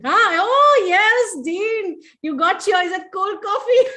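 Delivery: high, excited exclamation into laughter; high-pitched laughing delight; delighted reaction. Real, not acted. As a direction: react with glee, ending in a laugh.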